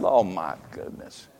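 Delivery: very low, hushed and slow; dismayed aside. Retold or imitated. Pastor hearing she shacked up with a fella, hushed dismay. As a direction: sink into a quiet, drawn-out groan of dismay. Reacting to awkward news about member.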